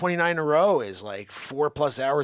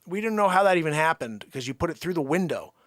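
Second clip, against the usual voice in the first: normal talking voice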